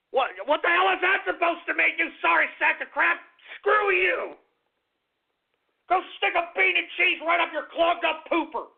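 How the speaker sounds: hammed-up fury over a rude username; angry outburst. Mock outrage at a viewer's name.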